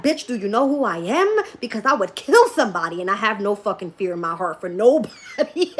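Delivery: loud, boastful comeback; brash mock retort. Retelling a comeback to the therapist, brash mock retort. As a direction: act out the defiant reply, laughing at the end.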